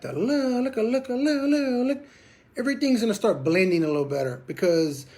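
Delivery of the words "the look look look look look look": higher, repeated look look look; halo-effect look calls. Shown as a demonstration. Instructor demoing rolling notes with the halo effect, high looks. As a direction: roll the look notes over. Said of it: "Once you get the halo effect".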